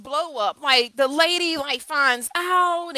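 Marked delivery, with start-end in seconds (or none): none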